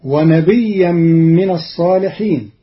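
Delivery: ونبيا chanted with a doubled ya, nabiyyan; tajweed chant; Quran recitation. Shown as a demonstration. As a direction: melodic recitation. Verse recited before the rule.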